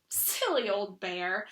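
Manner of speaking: soft, slow and tender; fond and gentle; loving. A narrator's reading. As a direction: Christopher Robin lovingly comforting stuck Pooh. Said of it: "in such a loving voice".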